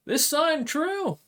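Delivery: high, loud call across the room; shouting. Acted. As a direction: call out a suspicious question, slowly. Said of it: "kinda shouts over in Common and goes".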